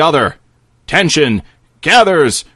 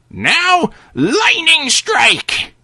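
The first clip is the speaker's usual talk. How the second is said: called spell; 10 semitones higher, much slower than usual. Acted. Old man casting a spell.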